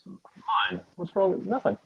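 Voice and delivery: cutting-out tinny line; through a tube. Real, not acted. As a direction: voice fading in and out. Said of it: "You keep disappearing".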